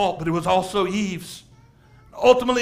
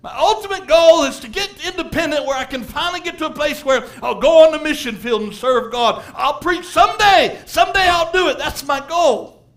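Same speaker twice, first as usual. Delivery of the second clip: loud, raised voice acting out an excuse; preacher's near-yell; 6 semitones higher, 6 dB louder than usual. Preacher mimicking a churchgoer's excuse, nearly yelling. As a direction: build to high, emphatic proclaiming. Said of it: "not trying to yell at you".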